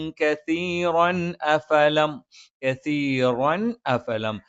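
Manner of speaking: കസീറൻ അഫലം chanted slowly, the tanween said clearly; clear recitation. Shown as a demonstration. Teacher showing the clear tanween in കസീറൻ അഫലം, slowly. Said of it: "വ്യക്തമായി ഉച്ചരിക്കണം".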